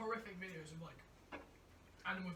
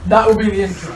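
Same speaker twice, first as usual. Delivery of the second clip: laughing; 33 dB louder than usual. Real, not acted. Joking, laughing aloud.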